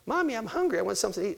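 put-on high child's whine, pleading; little Susie whining. Preacher voicing little Susie begging mommy for food. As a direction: beg like a hungry little girl. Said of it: "what does little Susie say?".

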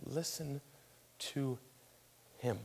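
very soft, halting divine command; hushed heavenly voice, word by word. Acted. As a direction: leave long pauses between the soft words. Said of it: "And a voice from heaven".

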